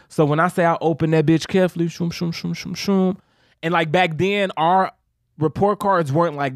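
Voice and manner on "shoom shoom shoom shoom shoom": swishing sound effect. Retold or imitated. Imitating opening envelope.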